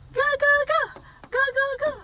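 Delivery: high, loud rapid cheering. Real, not acted. Cheering child again. (kusog nga pag-awhag)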